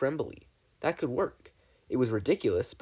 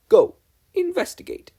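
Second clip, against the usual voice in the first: much higher, clipped order; sharp command; 10 dB louder than usual. A narrator's reading. Mabel ordering agents away, much higher and louder. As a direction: snap the order out.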